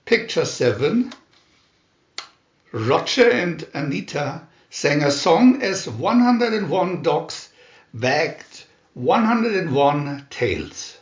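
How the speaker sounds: ordinary delivery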